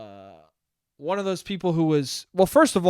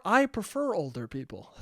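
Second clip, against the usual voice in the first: ordinary talk, nothing marked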